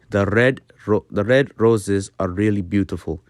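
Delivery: lightly rolled R in "red roses really"; gentle rolled R. Shown as a demonstration. Teacher demonstrating Dutch R, gently rolled "roses". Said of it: "so for a gentle R sound".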